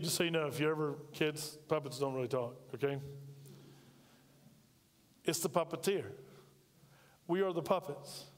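ordinary talk, nothing marked

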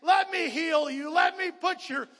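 loud, rising preacher's call over the music; full-force preaching. Acted. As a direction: preach it out, voicing God's call to the hurting.

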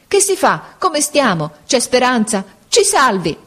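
high, urgent panicked questions; frightened pleading. A narrator's reading. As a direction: beg the captain to save you. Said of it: "gridarono tutti insieme".

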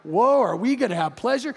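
excited, swooping delighted question; Sarah's excitement. Acted. Sarah hears they will have pleasure, excited and surprised. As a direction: play up the eager surprise of an old wife. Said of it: "that's what Sarah was like".

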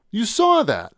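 high, incredulous appeal. A narrator's reading. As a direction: turn to your friend in disbelief. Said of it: "I said incredulously to Linda".

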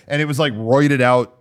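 ordinary delivery

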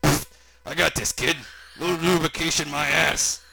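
dismissive oddball uncle voice; Uncle Creepy character. Acted. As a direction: brush it off cockily. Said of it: "Creepy said".

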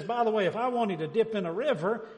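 loud, high, cocky retort; cocky Naaman. Acted. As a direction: boast like an offended big shot. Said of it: "That sounds a little cocky too".

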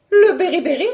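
high, loud, shocked question; high-pitched astonished wife; startled exclamation. Acted. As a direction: exclaim it in alarmed disbelief. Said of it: "s'exclamait Eudoxie".

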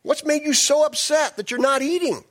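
a mock-worried wife; Jezebel asking. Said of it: "his wife Jezebel asked him".

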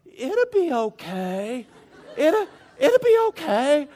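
slow, high, dreamy comfort, laughing through it; drugged reassurance. Retold or imitated. Patient still under anesthesia comforting a crying woman, slow and giggly. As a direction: play the loopy patient soothing a stranger. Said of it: "I was under the influence".

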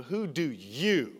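slow question landing on a stretched "you"; emphatic YOU. Shown as a demonstration. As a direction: stress on "you", drawn out slowly. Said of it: "It's emphatic".

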